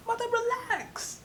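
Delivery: very high, drawn-out squeal; mock-desperate, stretched-out high whine; exasperated protest. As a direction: plead with mom in a squeaky high voice. Acted protest at her insistent mother.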